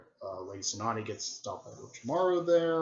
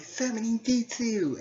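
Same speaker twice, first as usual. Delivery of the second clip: much higher, slow shouted jutsu call; Naruto casting a jutsu; 12 semitones higher than usual. Retold or imitated. Naruto trying the summoning, high and drawn out. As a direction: call out the technique like an eager kid. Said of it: "and she's like".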